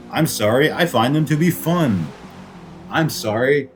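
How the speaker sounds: ordinary talk, nothing marked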